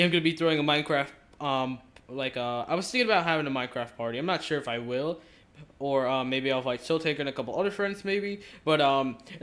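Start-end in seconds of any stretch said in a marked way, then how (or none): none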